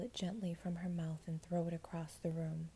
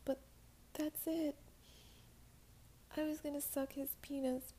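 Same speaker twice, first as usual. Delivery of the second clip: put-on small girl voice; 7 semitones higher than usual. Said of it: "in a voice so quiet I could barely hear her".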